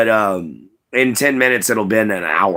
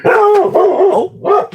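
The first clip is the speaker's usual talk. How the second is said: startled yelp; 6 dB louder than usual. Real, not acted. Dog suddenly barks at door.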